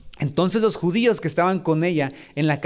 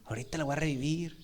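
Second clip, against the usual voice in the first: despreocupado ligero; 3 semitones lower, 15 dB quieter than usual. Pretend. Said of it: "simplemente fue como que".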